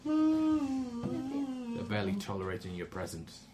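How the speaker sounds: dry put-down. Acted. Mocking the serving wench.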